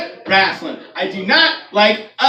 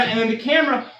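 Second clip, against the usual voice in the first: ordinary talk, nothing marked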